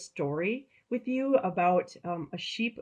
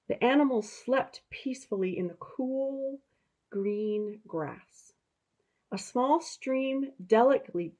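higher, storybook narration; reading to children; 4 semitones higher than usual. A narrator's reading. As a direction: tell it like a bedtime story to kids.

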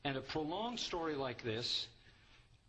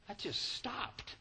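mock surprise; 11 semitones higher than usual. Preacher feigning puzzlement at the abrupt ending, surprised. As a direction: act baffled the story stops. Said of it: "feigned surprise at the ending".

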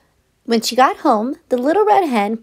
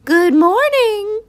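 high, slow hen call; 10 semitones higher, 4 dB louder, slower than usual. A narrator's reading. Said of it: "she called".

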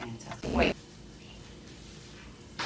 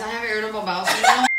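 loud blurt; 4 semitones higher, 9 dB louder, much faster than usual. Acted.

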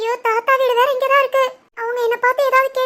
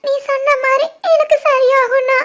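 usual speaking voice

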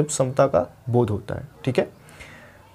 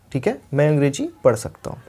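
normal talking voice

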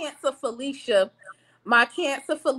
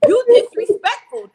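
louder, higher mock scolding; mock telling off; 7 semitones higher, 7 dB louder than usual. Leo calling someone out, mock loud scolding. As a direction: snap it like you're calling them out.

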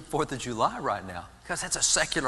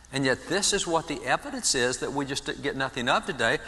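usual speaking voice

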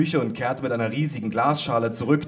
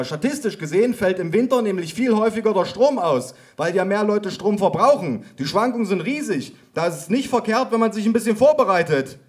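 lightly raised, eager know-it-all voice; eager Micha voice; 8 semitones higher, 3 dB louder than usual. A narrator's reading. Micha calls out his statistics, eager and raised. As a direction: lecture it eagerly, pitched up. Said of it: "rief Micha".

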